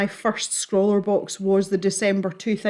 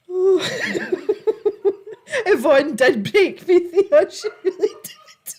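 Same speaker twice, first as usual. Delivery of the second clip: breathless high laughter through words; laughing so hard it nearly cries; 9 semitones higher, 4 dB louder than usual. Real, not acted. Laughing fit over quiche joke.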